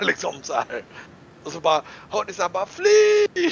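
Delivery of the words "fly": very high, loud drawn-out cry; acted high-pitched call to run; panicked crowd shout. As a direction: shriek a warning across the chaos. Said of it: "folk som ropar".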